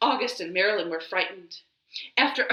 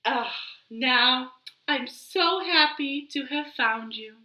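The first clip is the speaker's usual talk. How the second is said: doting mother; 8 semitones higher, 4 dB louder than usual. Acted. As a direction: coo over her lost baby. Alligator mother voiced.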